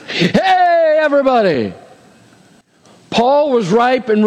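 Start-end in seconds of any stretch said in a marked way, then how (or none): 0.0-1.7 pitched high, hammed-up shout to a crowd; joking about God using a megaphone, hammed-up call; mock call out.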